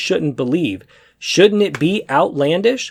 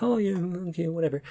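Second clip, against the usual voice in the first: softer, bored brush-off; dismissive shrug; 2 semitones higher, 8 dB quieter, faster than usual. Retold or imitated. Friends brushing off his story, dismissively. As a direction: wave it off like you don't care. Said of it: "they're kinda like".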